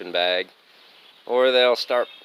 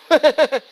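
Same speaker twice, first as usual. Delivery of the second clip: big laugh; 9 dB louder than usual. Real, not acted. Laughing at the uphill trail.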